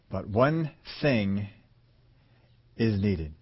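very slow, soft and low; slow, solemn. Acted. Jesus giving Martha the one needed thing, slow and soft. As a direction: weigh each word.

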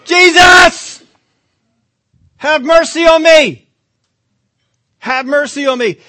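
loud high plea; crying out loud. Acted. Blind Bartimaeus cries out to Jesus, loud and high. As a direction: cry out for mercy over the crowd. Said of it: "I'm gonna get louder".

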